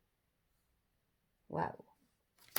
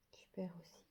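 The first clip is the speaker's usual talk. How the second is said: whisper; 17 dB quieter than usual. Real, not acted. Whispered aside over tarot cards.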